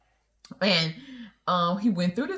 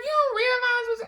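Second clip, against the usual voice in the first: much higher, mock exasperation; exasperated; 3 dB louder than usual. Wife scolds husband about the flirting woman, much higher. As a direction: start to scold him. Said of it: "I was like".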